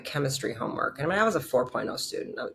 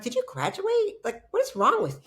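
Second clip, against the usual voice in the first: mock disbelief. Retold disbelief at doctors.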